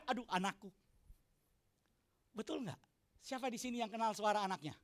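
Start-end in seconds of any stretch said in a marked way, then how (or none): 0.1-0.7 soft, lower fond murmur; tender sigh. Retold or imitated. Father hearing his child's voice from afar, softly tender. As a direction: melt at the sound of your child.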